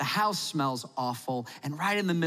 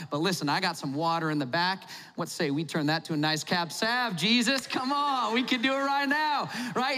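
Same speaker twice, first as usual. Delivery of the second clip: pushy host; 2 semitones higher than usual. Retold or imitated. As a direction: egg Jesus on to turn water into wine. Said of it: "And you're like".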